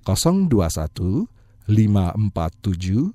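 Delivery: slow, spaced phone number; slow number read. Shown as a demonstration. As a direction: read each digit slowly. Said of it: "Saya ulangi pelan-pelan".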